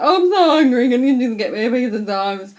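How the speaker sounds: high, loud hammed-up crying voice; imitating someone bawling; mock tearful whine. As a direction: wail it like an exaggerated hungry sob. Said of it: "What are you crying about?".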